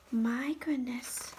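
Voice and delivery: slow hushed whisper; soft amazed whisper. Real, not acted. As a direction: breathe it out in awe. Whispered aside.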